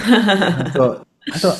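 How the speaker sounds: laughing through the words; a real laugh breaking into it. Real, not acted. Laughing at the quote.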